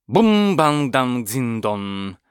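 slow drawn-out clanging; clattering sound effect. A narrator's reading. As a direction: spell out the crash slowly. Helmet clattering on rock.

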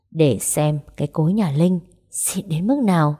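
usual speaking voice

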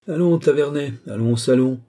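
high, loud, hearty summons; brisk urging. A narrator's reading. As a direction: urge an old friend along. Marshal urges his friend along.

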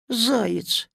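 ordinary delivery

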